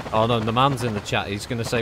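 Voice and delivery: normal talking voice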